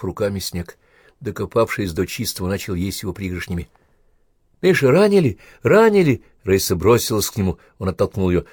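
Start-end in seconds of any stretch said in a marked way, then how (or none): 4.6-6.2 frightened cry. Acted. Girl rushes to wounded scout.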